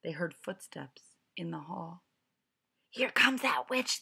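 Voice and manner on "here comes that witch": ordinary delivery, nothing marked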